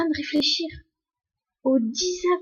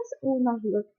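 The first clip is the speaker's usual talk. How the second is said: ordinary talk, nothing marked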